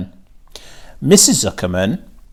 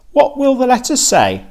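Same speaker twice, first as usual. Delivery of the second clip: higher, louder eager question; curious hired man; 13 semitones higher, 4 dB louder than usual. A narrator's reading. Lurvy asking the farmer about the crate, eager and raised. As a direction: ask it keen and wide-eyed.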